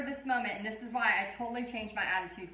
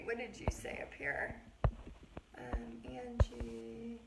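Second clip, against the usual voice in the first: soft unvoiced whisper to Angie; 6 dB quieter, slower than usual. Real, not acted. Art teacher whispering to a helper off camera. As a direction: whisper her name aside, slowly.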